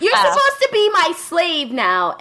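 put-on high-pitched girly shout; shouting vampire girl. Vampire girl yelling that Kohei must be her slave, high bratty shout. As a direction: yell it like a bratty little vampire.

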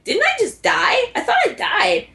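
high-pitched, incredulous revived hero; bewildered disbelief. Acted. Storyteller voicing Taito waking up after decapitation, bewildered disbelief.

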